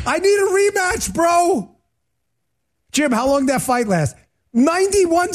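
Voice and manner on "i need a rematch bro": much higher, pleading demand; mock beaten boxer. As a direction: whine for a rematch like a sore loser.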